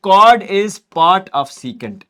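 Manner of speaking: each word drawn out; very slow dictation. Shown as a demonstration. Teacher dictating that a chord is part of a secant, slowly. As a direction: lay the words down slowly for notes.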